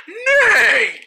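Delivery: high mock horse neigh; whinny the word like a horse. Kid answering hold your horses, mock neighing. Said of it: "Why are you acting like a horse?".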